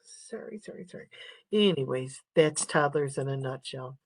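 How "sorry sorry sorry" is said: hushed, sheepish sorry; soft embarrassed whisper to the class; whispered apology. Real, not acted. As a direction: whisper it as if caught out. Apologizing mid-lecture, caught off guard.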